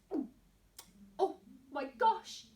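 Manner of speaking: ordinary delivery, nothing marked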